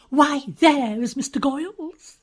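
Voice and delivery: light up at the sight of a friend; exclamation of pleasure. A narrator's reading. Miss Tarrant spotting Goyles across the lounge, delighted. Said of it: "uttered an exclamation of pleasure".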